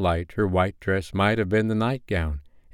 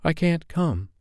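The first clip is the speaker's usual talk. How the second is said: higher, apologetic refusal; mildly sorry, pitched up; 8 semitones higher, slower than usual. A narrator's reading. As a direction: decline with a touch of apology. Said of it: "she said apologetically".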